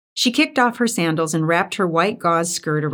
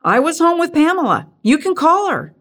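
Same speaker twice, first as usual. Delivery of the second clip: much higher, pleading alibi; defensive under interrogation; 10 semitones higher, 4 dB louder than usual. A narrator's reading. Hank defends himself to accusing Doris, higher and defensive. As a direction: protest like a suspect grilled by police. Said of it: "sounded as if he were being interrogated".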